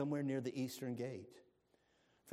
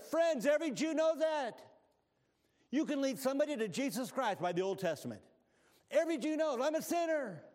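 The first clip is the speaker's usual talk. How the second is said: higher and louder pulpit preaching; preaching at full force; 7 semitones higher, 4 dB louder than usual. Acted. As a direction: drive it home to the congregation.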